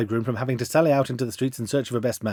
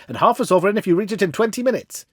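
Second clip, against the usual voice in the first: urgent offer; 7 semitones higher than usual. A narrator's reading. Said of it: "said I".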